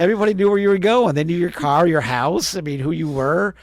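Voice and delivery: laughing excitement at how everyone knew everyone; excited. Real, not acted. To the host about his hometown, excited and loud.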